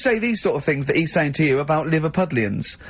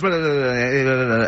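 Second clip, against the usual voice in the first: mock fast chatter; 3 semitones lower than usual. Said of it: "Robin sort of goes".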